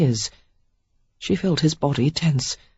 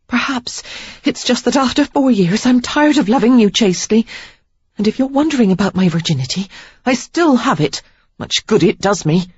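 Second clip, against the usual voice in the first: angry retort; 7 semitones higher than usual. A narrator's reading. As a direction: flare up and snap back. Sarah's anger bursts out.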